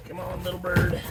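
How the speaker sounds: soft, gentle coaxing; coaxing a wild bird. Real, not acted. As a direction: hush it out like a pet.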